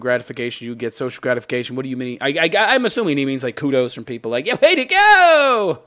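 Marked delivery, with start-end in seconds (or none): 4.5-5.8 high, slow mock cheer; mock cheering fan. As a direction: ham up a fan's praise. Imitates people giving kudos.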